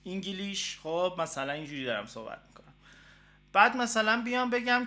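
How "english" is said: very slow English with an Iranian accent. Shown as a demonstration. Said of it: "مثلاً لهجه ایرانی دارم". (انگلیسی کند با لهجه ایرانی)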